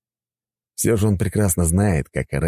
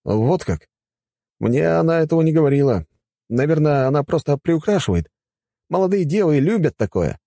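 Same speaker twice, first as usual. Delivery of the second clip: put-on lightly higher, courteous young man; polite young man voice; 10 semitones higher than usual. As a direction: speak as a respectful young god. Gauss answers about Rachel. (вежливый молодой мужчина)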